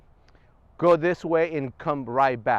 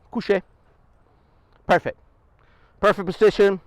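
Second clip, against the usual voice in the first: higher, loud praise after the command; command then praise; 4 semitones higher, 6 dB louder than usual. Real, not acted. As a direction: reward the perfect down. Commanding dog in store.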